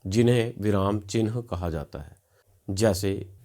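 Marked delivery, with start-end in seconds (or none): none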